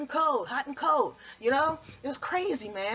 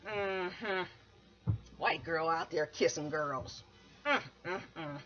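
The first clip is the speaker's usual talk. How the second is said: lower, slow disapproving sass; disapproving women; 7 semitones lower, 6 dB quieter, slower than usual. Retold or imitated. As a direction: tut at her with a slow mm-hmm.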